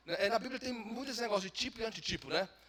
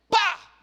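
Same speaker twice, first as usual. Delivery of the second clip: loud strike sound; 13 semitones higher, 14 dB louder than usual. Acted. Striking the rock.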